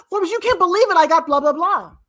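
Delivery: high, loud, gushing mock excitement; excited caller. Caller phoning Forbes with unbelievable news, gushing excitedly. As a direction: burst out with the big news.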